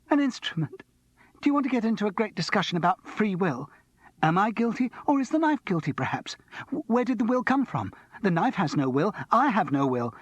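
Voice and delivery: high, excited rant, voice leaping up; a feverish student spiralling into argument; Martin, manic. A narrator's reading. Martin lecturing Charles on guilt, high and frantic. Martin arguing free will.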